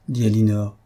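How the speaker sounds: usual speaking voice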